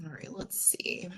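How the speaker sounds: whispered under the breath; whispering to self. Real, not acted. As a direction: mutter it quietly while reading. Reading chat, muttering to self.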